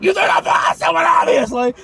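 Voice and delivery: high-pitched outraged impression of a scolding; high, strained mock shouting; angry mock yell. Retelling the angry parent again.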